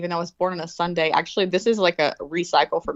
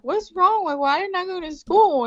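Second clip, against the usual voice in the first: put-on much higher, puzzled kid voice; confused child; 12 semitones higher than usual. Daughter asking her crying mom, high puzzled voice. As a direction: ask innocently like a sleepy child. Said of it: "I'm like".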